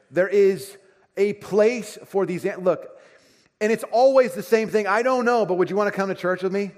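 loud, high, slowed emphasis from the pulpit; impassioned preaching. Acted. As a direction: drive it home to the congregation. Preaching at full force.

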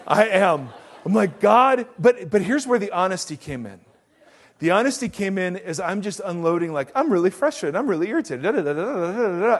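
loud, high, driving sermon voice; preaching at full force. Acted. Preacher telling the congregation his van story at full force. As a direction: belt the story out to the whole room.

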